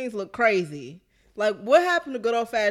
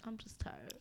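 whispering; 22 dB quieter than usual. Real, not acted. Weary repeated complaint.

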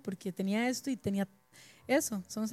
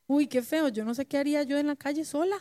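higher, louder imitation of a scared young daughter; daughter's voice; 5 semitones higher, 3 dB louder than usual. Mother quoting her crying daughter, higher and louder. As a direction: echo the girl's frightened cry, a touch higher.

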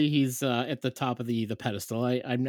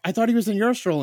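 higher, louder alarmed retort; retold rising panic at your spouse; alarmed parent; 9 semitones higher, 6 dB louder, much faster than usual. Dad to his wife over missing son, alarmed.

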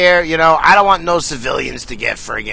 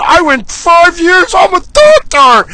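much higher, slow, whiny put-on voice; mocking graduate; 13 semitones higher, 6 dB louder, slower than usual. Mocking a Harvard doctor in a high, whiny voice. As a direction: whine it like a smug snob.

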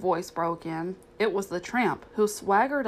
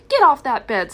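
loud, higher, sharp order; snapped; 5 semitones higher, 11 dB louder than usual. A narrator's reading. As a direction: snap it loudly at the dog. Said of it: "snapped Aunt Sarah".

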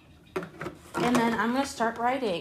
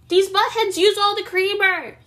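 exasperated; 11 semitones higher, 4 dB louder than usual. Real, not acted. Complaining about roommates.

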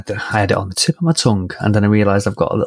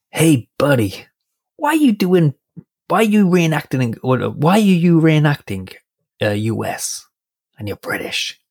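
put-on gruff American accent; 6 semitones higher than usual. Veteran questions a British reenactor at a signing, gruff American accent. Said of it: "in this kind of gruff American accent".

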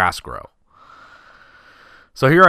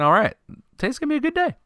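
excited inner voice; much faster than usual. Retold or imitated. Boy before the championship game, giddy and high. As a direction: pitch jumps way up on a good day. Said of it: "I'm thinking".

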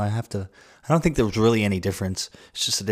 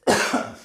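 breathy unvoiced cough; choking on a drink. Real, not acted. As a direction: cough out the stray water. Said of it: "Water went down the wrong pipe".

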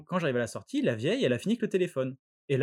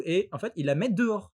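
usual speaking voice

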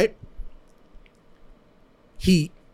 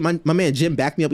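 ordinary talk, nothing marked

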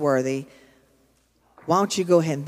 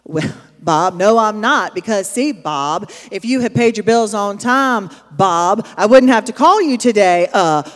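mock scolding; 3 semitones higher, 7 dB louder than usual. Mock-scolding phone call to Bob.

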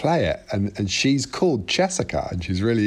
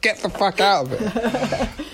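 higher, slow, laughing telling-off; laughing mock order; 11 semitones higher, slower than usual. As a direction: shoo him away while laughing. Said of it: "and I was like".

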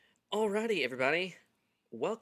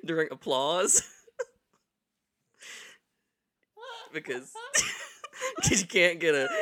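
laughing; 6 semitones higher, 9 dB louder than usual. Real, not acted. Laughing through a funny story.

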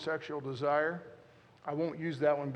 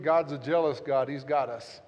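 ordinary delivery

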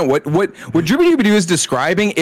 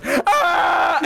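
high shouted "ah" in imitation; mock singing scream; 13 semitones higher than usual. Imitating a sitcom guitarist, high comic wail. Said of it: "and he goes".

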